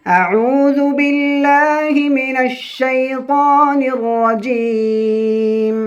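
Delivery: slow, high melodic chanting of the verse; Quran recitation. Shown as a demonstration. Teacher opening the Surah lesson with slow high recitation.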